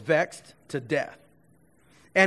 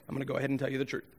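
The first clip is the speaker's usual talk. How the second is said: mock confession; 6 semitones lower, 10 dB quieter, much faster than usual. Samson mumbling fake confession.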